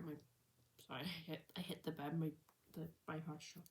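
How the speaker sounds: normal talking voice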